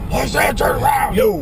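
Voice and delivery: loud high mock biker shout; gravelly biker drawl. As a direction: bark it like a tough biker. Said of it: "they should use a biker's voice".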